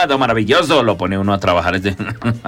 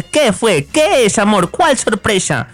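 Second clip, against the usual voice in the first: higher, excited curious husband; eager husband; 5 semitones higher, slower than usual. Acted. Husband asking about the surprise, excited. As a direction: jump at the news as the husband. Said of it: "el marido responde".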